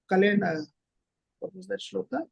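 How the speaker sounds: normal talking voice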